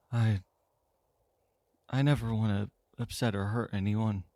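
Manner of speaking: halting, subdued voice; downcast, with long pauses; slow, low tone. Acted. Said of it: "slow, low tone".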